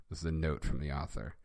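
ordinary delivery

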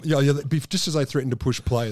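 ordinary talk

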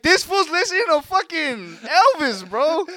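high mocking brother voice. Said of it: "They're like".

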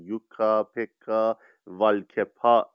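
spelling out the Finnish name syllable by syllable, slowing on والکپا; تلفظ آزمایشی و کشیدهٔ «یوکا، پکا، والکپا»; Finnish name pronunciation. Shown as a demonstration. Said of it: "سعی کردم تلفظ صحیح". (تلفظ شمرده و آهستهٔ «والکپا»)

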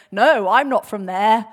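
loud, slow protest; acted denial. Peter denying he comes from Galilee, loud protest. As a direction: deny it firmly, drawing out the words. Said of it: "he's trying to say".

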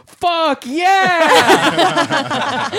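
high loud cheer; joyful shout. Retold or imitated. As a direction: whoop it like ecstatic construction workers. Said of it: "in unison they go".

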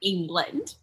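"England" said softly with a questioning lift; adorable England. Pretend. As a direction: coyly repeat "England", soft and rising. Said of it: "say England some more times 'cause it's adorable".

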